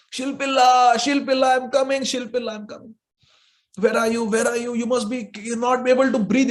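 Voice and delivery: high, urgent, desperate; urgent anguished call. Acted. Bride calling to her drowning stone, urgent and high. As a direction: call out as if a loved one drowns.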